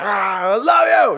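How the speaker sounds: high, loud exasperated shout, acted out; mock angry yell. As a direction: yell it like the end of a family fight. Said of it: "I'd be yelling at my dad".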